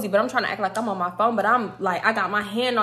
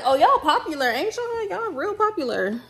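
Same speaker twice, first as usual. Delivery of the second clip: teasing; 9 semitones higher than usual. Retold or imitated. She teases Juvie about attention.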